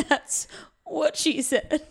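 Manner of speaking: laughing. Real, not acted. Cracking a joke, laughing.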